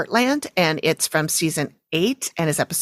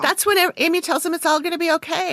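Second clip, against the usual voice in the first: much higher teasing voice; 10 semitones higher than usual. Pretend. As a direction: tease her in a high mock-sweet tone. Teasing mock narration.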